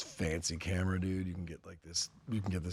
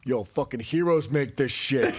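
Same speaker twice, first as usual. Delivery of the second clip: slow, louder and higher shout; retold shout; 6 semitones higher, 6 dB louder, slower than usual. Guy on the video yelling his ad-lib line, louder and higher. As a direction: yell it like a hyped-up buddy. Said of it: "And the guy's like".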